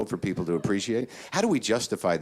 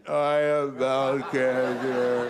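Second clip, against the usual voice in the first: very slow, drawn-out hammed-up gloom; mock despair; 5 semitones higher, much slower than usual. Comic acting out the depression stage, hammed-up gloom. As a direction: sink into theatrical despair. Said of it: "And then depression".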